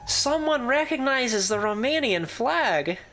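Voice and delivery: slow, high and delighted. Real, not acted. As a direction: savour the joy of it.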